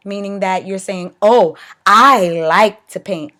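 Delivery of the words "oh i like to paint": slow, loud, stressing "I" in "Oh, I like to paint."; emphatic. Shown as a demonstration. Teacher demonstrating what emphatic liking sounds like. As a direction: lean hard on "I", drawn out. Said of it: "just emphatic".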